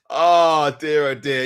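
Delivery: normal talking voice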